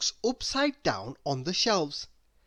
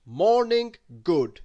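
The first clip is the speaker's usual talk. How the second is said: louder, slow hearty hello; cheery greeting; 7 dB louder, slower than usual. A narrator's reading. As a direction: call out a cheerful good morning. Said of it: "people started saying".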